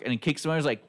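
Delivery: ordinary delivery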